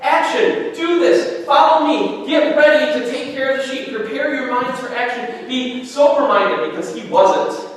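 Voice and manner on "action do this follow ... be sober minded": higher, louder preaching call; rousing exhortation. Acted. Preacher reading Peter's epistle to the church, loud and rousing. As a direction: fire the congregation up to act.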